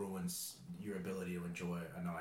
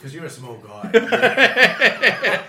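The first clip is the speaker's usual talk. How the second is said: drawn-out line through a big laugh; slow, loud laughing jab; 13 semitones higher, 23 dB louder, much slower than usual. Real, not acted. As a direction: tease him while cracking up. Cohost laughing at the story.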